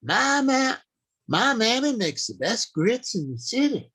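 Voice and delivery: high, lilting American Southern accent. Shown as a demonstration. Writing teacher demos a Southern character line in Southern accent. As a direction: say it like a proud Southern child, Southern accent. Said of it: "You place them in the American South".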